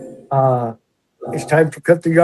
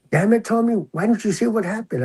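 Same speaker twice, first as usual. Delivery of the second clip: higher, exasperated scolding; mock a fed-up lieutenant chewing him out; exasperated boss; 6 semitones higher, 3 dB quieter than usual. Boss chews out officer at morning meeting, exasperated scold.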